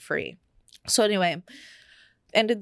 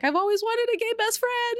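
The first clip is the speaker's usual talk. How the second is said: high, squealing imitation of the best friend; gushing best friend. Friend gushing over the news, squealing high. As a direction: chirp it way up like a thrilled teen girl.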